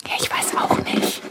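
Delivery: hushed whisper while hiding. Acted. Yvonne hiding from the mouse, whispering. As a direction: whisper so the mouse won't hear.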